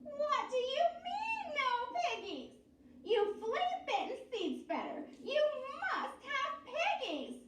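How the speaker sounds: very high witch demand; screeching witch. A narrator's reading. Witch screeching at the farmer, high.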